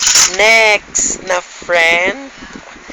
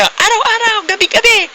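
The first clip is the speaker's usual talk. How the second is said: quoted friend; 13 semitones higher than usual. Retold or imitated. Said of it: "Sabi niya".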